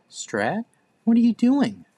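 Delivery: high, bright little Kewpie-doll voice; a tiny sweet cherub calling from the doorway; sweet bubbly cherub voice. A narrator's reading. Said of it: "a Kewpie voice from the doorway".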